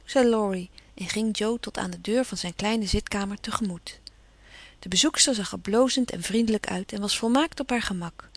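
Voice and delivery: ordinary delivery, nothing marked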